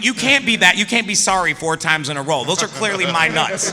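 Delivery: high, loud mock-indignant protest; hammed-up outrage at the kicks. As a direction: complain with a laugh in it. Retold protest to co-actor.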